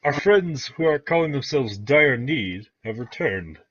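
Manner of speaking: loud, high, slow proclamation; Jared announcing. Acted. Jared announces the party's return to Nighthill, loud proclamation. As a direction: announce it grandly to the hall. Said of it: "you hear Jared go".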